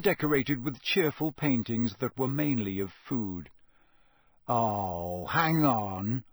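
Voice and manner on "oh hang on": drawn-out, dawning realisation; slow, suspicious hesitation; slowly. A narrator's reading. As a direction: say it slowly as it dawns on you. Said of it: "said Harry slowly".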